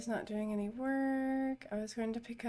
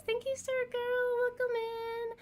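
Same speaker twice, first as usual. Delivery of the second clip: excited; 12 semitones higher than usual. Real, not acted. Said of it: "I got so excited I lost my voice".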